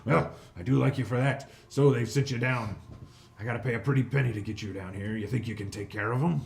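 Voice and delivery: loud, bossy crime-lord hobgoblin; greedy hobgoblin boss. Acted. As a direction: bark orders like a greedy fat boss. Hobgoblin bargains with party.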